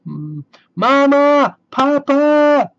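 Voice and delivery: high, loud panicked call for parents; frightened cry. Acted. Scared sister calling her parents at night, high panicked cry. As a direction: scream for help in the dark. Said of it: "she cried".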